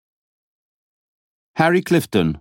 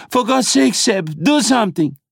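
panicked plea; 12 semitones higher than usual. A narrator's reading. Terrified passenger pleading.

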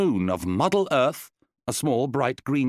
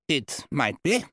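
defensive; 4 semitones higher, slower than usual. A narrator's reading. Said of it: "replied Randolph, defensively".

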